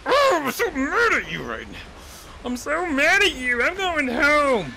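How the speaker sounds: hammed-up sulky rage; mock angry pig. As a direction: stomp off in a cartoon huff. Pig sulking in anger.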